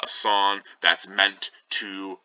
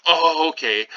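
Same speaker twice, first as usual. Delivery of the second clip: surprised nurse; 8 semitones higher, 5 dB louder than usual. Retold or imitated. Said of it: "she's like".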